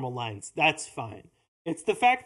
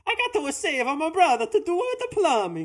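high, louder cartoon Italian plumber; over-the-top mock Italian, pitched way up; Mario; 11 semitones higher, 4 dB louder than usual. Shown as a demonstration. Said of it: "imagine if it was".

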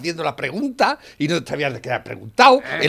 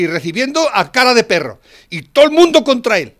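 loud, higher emphatic ranting; heated rant; 6 semitones higher, 8 dB louder than usual. Real, not acted. Man praising Trump to his friend, loud heated rant. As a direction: hammer home every phrase.

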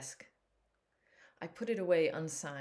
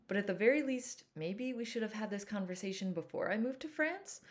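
usual speaking voice

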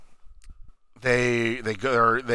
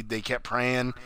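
ordinary talk

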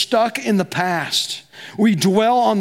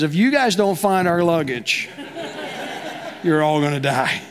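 laughing through it. Retold or imitated. Husband's mock threat to crew.

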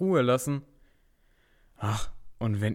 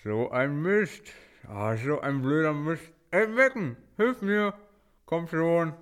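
gagged and pleading; 4 semitones higher than usual. Acted. Toni tied up calling Megan.

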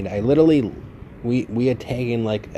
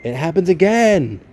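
exasperated; 8 semitones higher, 7 dB louder than usual. Real, not acted. Podcast interrupted by messages again.

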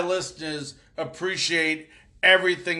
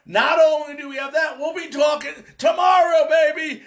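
hyped up; 8 semitones higher than usual. Real, not acted. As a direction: pump up the audience. Hosts hyping tomorrow's draft show.

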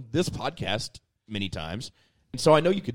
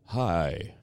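low, stilted mic voice; artificial radio voice; 5 semitones lower, much slower than usual. Retold or imitated. Teasing cohost over first commercial, mock radio voice. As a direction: imitate someone stiffening up on microphone. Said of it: "as soon as that microphone turned on".

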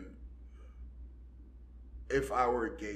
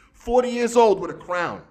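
high, loud disbelieving outburst; a loud, high jab at grown-ups in crowns; incredulous shout; 11 semitones higher, 9 dB louder than usual. Real, not acted. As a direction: throw the age out in loud disbelief. Ranting at birthday crowns.

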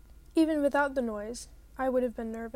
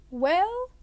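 sharp, loud, higher Well?; loud demand; 6 semitones higher, 8 dB louder than usual. A narrator's reading. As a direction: bark the question at him. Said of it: "she demanded".

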